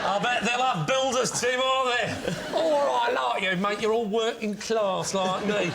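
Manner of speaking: laying the northern charm on thick; northern accent. Pretend. Lee buttering up the floor sander in a northern accent. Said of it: "getting more northern".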